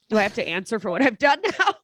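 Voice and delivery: laughing through the mock-fearful question. Imagining the father's fear of judgment, laughing through it.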